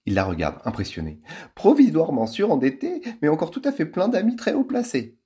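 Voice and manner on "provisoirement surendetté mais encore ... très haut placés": affected countess. A narrator's reading.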